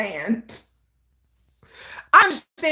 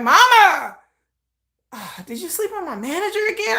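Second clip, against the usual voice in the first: exasperated son; 7 semitones higher than usual. Retold or imitated. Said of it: "He'd be like".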